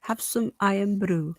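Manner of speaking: slow, hammed-up Scottish accent on Have some Irn-Bru; a half-hearted try at a Scottish voice; bad Scottish accent. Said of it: "I can't do the Scottish".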